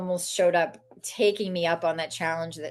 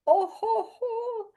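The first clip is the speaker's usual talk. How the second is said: high laughing oh ho ho; startled nervous chuckle; much slower than usual. Retold or imitated. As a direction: laugh it off awkwardly. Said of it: "And I went".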